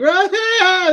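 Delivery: very high, loud, yowling wail like a cat in heat; hammed-up ugly-cry screech; imitated wailing cry. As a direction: let out a screeching cat-like howl. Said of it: "It was like cats in heat".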